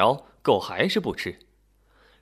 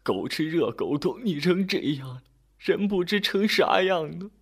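put-on high, tearful old woman; old mother's voice breaking with worry; choked with tears; 6 semitones higher than usual. A narrator's reading. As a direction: choke it out through acted sobs. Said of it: "老太太哽咽地说".

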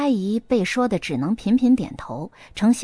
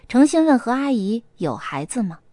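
plain reading voice

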